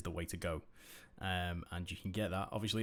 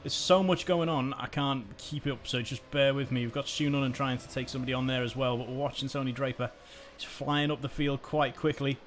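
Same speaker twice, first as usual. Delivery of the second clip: energetic play-by-play, louder; live race commentary; 4 semitones higher, 5 dB louder than usual. Acted. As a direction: call the race as it unfolds.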